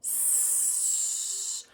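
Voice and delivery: "s" hissed through rounded lips; windy sound. Shown as a demonstration. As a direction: blow "s" with pushed lips. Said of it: "lip protrusion while they produce the S".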